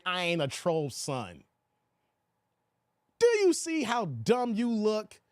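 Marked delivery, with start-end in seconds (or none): 0.0-1.4 lower, softer mock of the commenter; mocking the troll. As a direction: sneer it back at him, dropping away. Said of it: "avatar saying".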